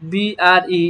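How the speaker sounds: ordinary talk, nothing marked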